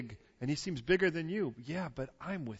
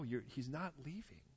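ordinary talk

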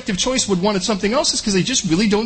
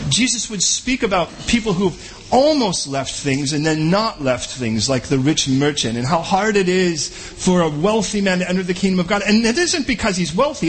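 ordinary talk, nothing marked

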